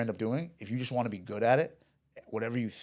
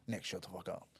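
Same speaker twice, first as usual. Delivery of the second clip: retelling it low-key, much softer; retold hushed warning; 3 semitones lower, 10 dB quieter than usual. Salesman to his friend as a gunman approaches, hushed retold warning. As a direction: imitate an under-the-breath warning to a friend.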